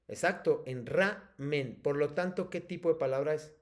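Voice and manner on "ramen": "ramen" slow, RA stressed, men set apart. Shown as a demonstration. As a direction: lean on RA in "ramen", pause, then men. Teacher confirms the stressed syllable.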